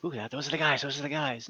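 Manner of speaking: soft, hushed tavern gossip; gossiping whispers. A narrator's reading. Game master voicing tavern patrons gossiping, hushed murmur. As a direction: murmur it like patrons talking behind their hands. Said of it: "there's whispers".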